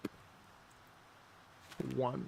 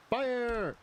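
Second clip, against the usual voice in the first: high, loud command to fire; shouting fire; 12 semitones higher, 3 dB louder than usual. Real, not acted. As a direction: yell the order, much higher. Firing artillery in game.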